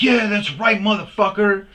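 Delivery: hammed-up bully victory shout; gloating shout. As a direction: crow over a beaten man. Attacker gloats after punch.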